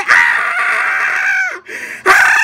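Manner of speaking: high pained screams. Retold or imitated. Boy screaming as the pepper burns. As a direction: scream out in pain.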